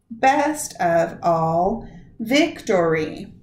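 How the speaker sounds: slow, "Best" and "vic" hit hard; scanning the stresses. Shown as a demonstration. Teacher slowly scanning the line for the class's stresses. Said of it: "each syllable getting either stressed or unstressed".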